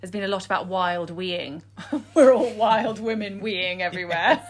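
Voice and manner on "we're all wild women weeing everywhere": talking through laughter; laughing. Real, not acted. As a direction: giggle through the line. Joking about wild weeing.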